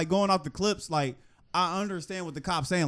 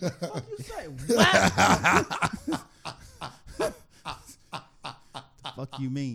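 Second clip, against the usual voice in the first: spluttering outrage. Retold or imitated. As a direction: splutter in fake disbelief. Acted cop retort to badge question.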